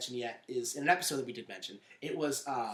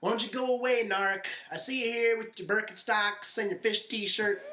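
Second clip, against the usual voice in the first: higher-pitched imitation of a taunt; mock heckling; 6 semitones higher than usual. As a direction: play at telling someone off. Acted heckling quote from the show.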